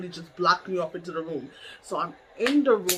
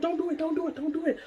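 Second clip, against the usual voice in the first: quick, higher, hushed plea; retold urgent pleading; 9 semitones higher, 4 dB quieter, faster than usual. Coworkers holding her back, urgent and higher. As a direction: imitate friends urgently pleading.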